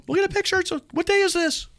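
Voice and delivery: very high squeaky kid voice imitating his children; kids squealing. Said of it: "they're like".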